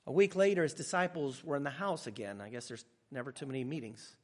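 ordinary talk, nothing marked

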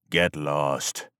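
a curt warrior's brush-off; low, cold dismissal. A narrator's reading. The gunman sends the reporter away, low and soft. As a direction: quietly tell him to get lost.

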